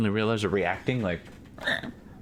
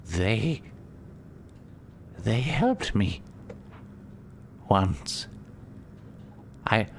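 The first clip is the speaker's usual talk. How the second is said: a lightly voiced weak creature, slow and higher, with pauses; weak little voice; 5 semitones higher, 3 dB quieter, much slower than usual. Exhausted Lulu speaks telepathically to the party, weak and halting. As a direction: barely get the words out, as if fading. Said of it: "this weakened little voice".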